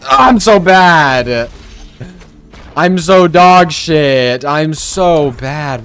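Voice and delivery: drawn-out self-pitying wail, easing off; mock wailing. Gamer bemoaning his own play, wailing. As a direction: lament slowly, starting high and loud.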